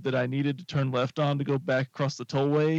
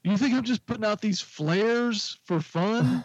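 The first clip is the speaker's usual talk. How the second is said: high-pitched sarcastic challenge, imitated; mock scolding officer; 7 semitones higher than usual. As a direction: imitate an officer telling off a clueless driver. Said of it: "And she's like".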